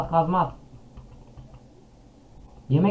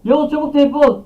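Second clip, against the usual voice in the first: loud high frantic shout; urgent shouting; 12 semitones higher, 8 dB louder than usual. Real, not acted. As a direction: yell urgently at a friend. Urgently calling friend to teleport.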